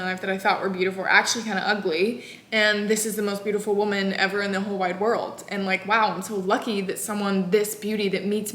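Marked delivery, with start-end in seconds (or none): none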